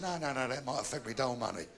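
put-on low-pitched old man's refusal; cautious grandad. Grandad refusing prostitute's offer, low and cautious.

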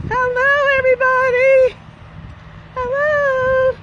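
high, sing-song greeting with held vowels; calling out hello. Real, not acted. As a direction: call cheerily across to the passers-by. Calls greeting to people out there.

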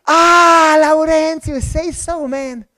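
high, loud relieved "ah"; light up as the name clicks; sudden recognition. Retold or imitated. Recognizing his old friend.

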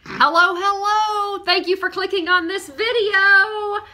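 high, loud greeting to viewers; bubbly video greeting. Pretend. Thanking viewers for clicking, in a high, bubbly voice. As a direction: pitch it way up to welcome everyone.

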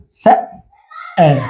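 "سأل" slowly, broken at the hamza; split demonstration. Shown as a demonstration. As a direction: say "سأل" in two parts, sa' then aal. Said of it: "هكذا، وممكن أن نقولها". ("سأل" مقطعًا ببطء عند الهمزة)

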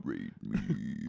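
very low, soft possessed chant; eerie murmur, far down in pitch; Katie Maloney. Retold or imitated. Imitating Katie destroying the town, low eerie chant. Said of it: "Katie going".

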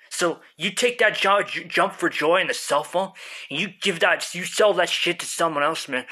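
ordinary talk

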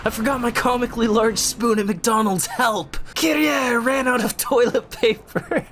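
high, hammed-up yelling over trivia; Nero, intensely dramatic. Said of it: "always intensely dramatic Nero".

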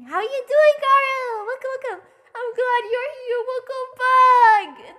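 high, loud squeal, last word stretched; excited welcome. Real, not acted. Streamer welcoming a viewer back, excited. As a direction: call it out high and drag out back.

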